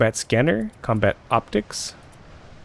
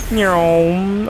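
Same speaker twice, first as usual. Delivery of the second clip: high, long nyoom rising at the end; playful zooming noise made by mouth; imitated engine vroom; 8 semitones higher, 3 dB louder than usual. Player launching the vehicle in game, high imitated vroom.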